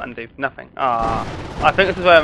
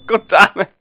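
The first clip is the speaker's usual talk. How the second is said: ordinary delivery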